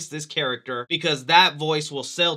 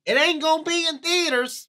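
mock dramatic letdown; 10 semitones higher, 4 dB louder than usual. Real, not acted. Deadpan reveal after hopeful quote.

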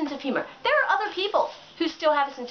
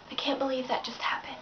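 breathy mocking whisper, softer; 7 dB quieter than usual. Mimicking an attention-seeking poster in a whisper. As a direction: whisper it like a fake tragic secret.

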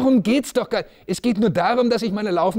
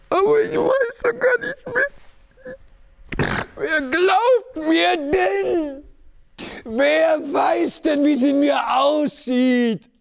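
high, slow, sobbing cry; crying outburst; 9 semitones higher, much slower than usual. Acted. Hunted man crying out that nobody believes him. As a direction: wail it out in despair. Monologue breaks into crying shout.

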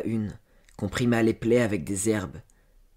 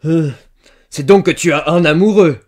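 very loud, high, furious accusation; shouted in her face; 6 semitones higher, 15 dB louder than usual. A narrator's reading. Farmer accusing servant girl of having a lover, shouting. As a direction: bellow it right at her. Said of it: "lui cria dans la face".

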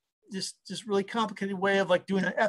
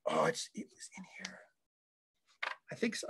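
whispered aside; 2 semitones higher, 13 dB quieter than usual. Real, not acted. Muttering while searching bookshelf.

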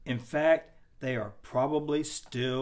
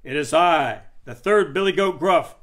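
booming big goat; big loud voice; 2 semitones higher, 9 dB louder than usual. A narrator's reading. Third goat answering the troll, big and loud. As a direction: announce yourself at full volume. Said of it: "big and loud as the troll's".

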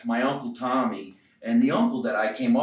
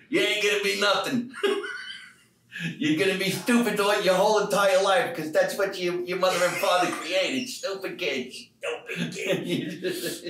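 Uncle Tommy; 7 semitones higher than usual. Pretend. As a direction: scold the kids like a cranky old uncle. Uncle voice again, laughing.

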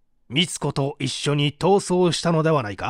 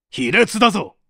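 contemptuous reproach; 2 semitones higher, 3 dB louder than usual. Acted. Interrogator accuses the suspect.

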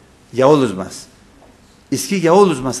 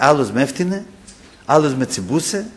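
ordinary talk

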